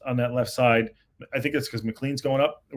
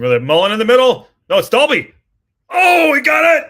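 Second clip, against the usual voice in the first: excited shout; 11 semitones higher, 11 dB louder than usual. Real, not acted. Fan commentator sees goal.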